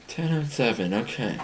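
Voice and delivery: hushed private check of a receipt; reading to oneself. Retold or imitated. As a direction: read it quietly, as if only for yourself. Reads receipt to herself.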